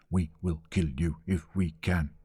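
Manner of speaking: low, ominous drumbeat chant; measured, menacing rhythm; war-drum message. A narrator's reading. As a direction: beat out each word separately like a drum. Voicing the war drums' message.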